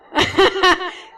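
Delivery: loud, high burst of delighted laughter; real laugh. Real, not acted. Telling the triplets story to patients, joyful laugh. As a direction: laugh out in joy.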